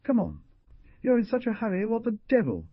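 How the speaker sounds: high-pitched, mildly harsh retort; irritated storybook exclamation, high; harsh reply. A narrator's reading. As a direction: snap back impatiently, pitched well up. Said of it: "The other replied harshly".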